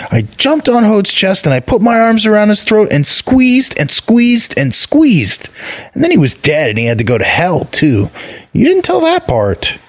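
put-on high, excited little boy voice; a small proud child bragging about his deed; high childish voice rising in excitement; boastful child god. A narrator's reading. Child god Vali tells his tale.

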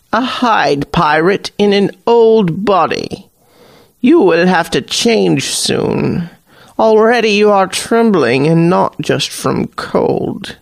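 high knowing hag voice, unhurried; an old sea witch reading the pirate; Hag Queen. Acted.

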